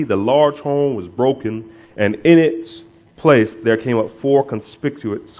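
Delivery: loud, declaimed pulpit reading; scripture reading. Real, not acted. Preacher reading Daniel's vision to the congregation, declaimed scripture reading. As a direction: read it out with weighty, punched key words.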